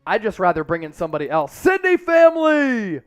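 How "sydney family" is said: high, loud, drawn-out holler; rousing shout-out. Real, not acted. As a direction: call out to the whole crowd. Hailing Australian viewers.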